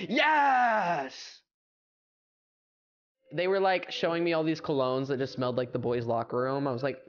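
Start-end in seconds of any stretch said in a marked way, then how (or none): 0.1-1.4 high, drawn-out cheer; excited whooping yes. Real, not acted. Streamer reacting to the cologne tweet, high excited whoop. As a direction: let out a long high whoop.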